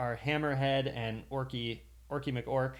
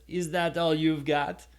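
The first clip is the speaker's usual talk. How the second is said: attempted Russian accent sliding into French, louder and higher; 5 semitones higher, 7 dB louder than usual. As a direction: taunt in a botched French-sounding accent. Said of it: "Sorry, that's a French accent out there".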